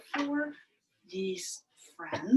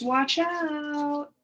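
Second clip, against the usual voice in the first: a higher, louder little snake voice; snake warning; 6 semitones higher, 4 dB louder than usual. Acted. Teacher voicing the snake to the class, higher and louder. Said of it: "Snake says".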